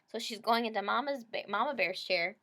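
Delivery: usual speaking voice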